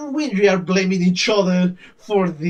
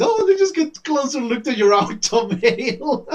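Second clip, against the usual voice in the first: higher-pitched, playfully creepy voice; creepy admirer; 6 semitones higher than usual. Retold or imitated. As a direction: imitate a creepy-funny gawker, breaking into a laugh. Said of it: "kind of creepy, kind of funny".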